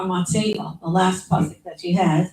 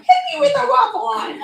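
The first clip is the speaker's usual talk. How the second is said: "Hit me with a waffle iron" squeaked much higher through a laugh; laughing catchphrase. Retold or imitated. Annoyed speaker using her sister's line, high and laughing. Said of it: "I'm like".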